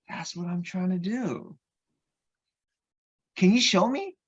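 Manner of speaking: rising eagerness, can you show me; retelling his eager younger self; eager plea. Retold or imitated. Eager to learn the trick.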